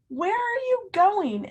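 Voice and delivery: high, slow little bird asking; a small bird calling out curiously. A narrator's reading. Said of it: "asks a bird flying by".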